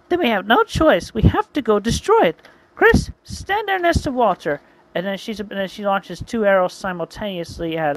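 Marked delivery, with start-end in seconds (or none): 0.1-4.6 high, loud female character voice; urgent heroine giving orders; commanding heroine. Acted. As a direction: take charge in a high, raised voice.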